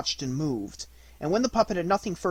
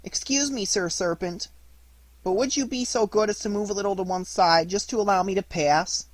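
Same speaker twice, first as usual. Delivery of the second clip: higher, coaxing puppet plea, not soft; insinuating voice; 6 semitones higher, 4 dB louder than usual. Acted. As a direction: wheedle the serpent politely. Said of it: "in a little soft, insinuating voice".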